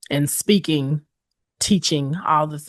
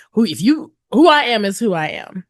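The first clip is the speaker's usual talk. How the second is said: usual speaking voice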